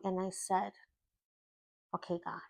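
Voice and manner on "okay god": quiet surrender. Retold or imitated. Surrendering prayer to God.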